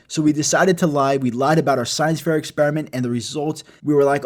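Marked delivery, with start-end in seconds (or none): none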